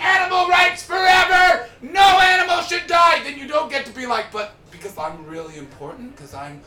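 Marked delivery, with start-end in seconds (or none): 0.0-3.2 much higher, much louder protest chant; mocking activist chanting loudly; mock slogan shout. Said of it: "If you're gonna be like". 4.3-6.6 lightly voiced posh English lady, softer; a hint of a smug posh lady; mildly imitating a privileged activist, softer. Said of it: "a posh blonde lady from Lo-, England".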